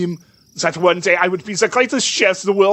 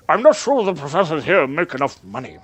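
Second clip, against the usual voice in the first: ordinary talk, nothing marked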